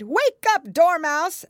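loud, high wake-up call; crying out. A narrator's reading. Hatter and March Hare waking Dormouse, loud cry. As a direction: call out sharply to rouse a sleeper. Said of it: "they both cried".

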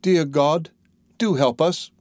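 mild storybook lament; crying out. A narrator's reading. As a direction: plead for help as a despairing little girl, understated. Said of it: "she cried".

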